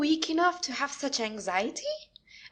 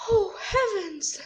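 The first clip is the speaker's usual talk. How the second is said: drawn-out, higher cry of dismay; dismayed gasp; 8 semitones higher, slower than usual. Acted. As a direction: she realises she is trapped, slow. Caught outside, father summoned.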